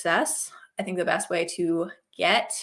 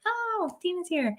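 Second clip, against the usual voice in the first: high, delighted little "oh"; happy surprise; 10 semitones higher, 4 dB quieter than usual. Retold or imitated. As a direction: perk up as if spotting a friend. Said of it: "I catch your name and I'm like".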